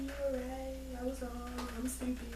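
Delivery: high, hushed mock apology; groveling Mellie. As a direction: grovel in a thin, high, small voice. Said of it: "she goes running to Olivia".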